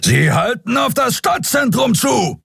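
high, far, forceful shout; captain roaring at his bridge crew. Acted. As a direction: bark the report like a furious hunter. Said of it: "brüllte Kapitän Sron".

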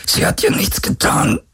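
very high, strained protest; agonised, squeezed plea; forced out with effort. A narrator's reading. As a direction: plead through pain, voice nearly gone. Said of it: "brachte Galen hervor".

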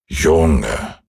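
ein Hauch von altem, geduldigem Mond; milde, gelassen mahnend; gutmütiger Mond. Acted. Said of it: "sagte der gute alte Mond". (leicht gespielte Mondstimme)

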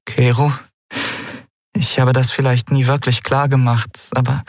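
high, hesitant female character; earnest woman. Acted.

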